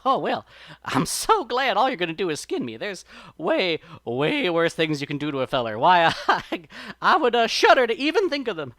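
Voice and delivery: high, loud rabbit voice full of laughing relief; feigned relief. A narrator's reading. Brer Rabbit bluffs the fox holding him, loud showy relief. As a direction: exaggerate how relieved you are.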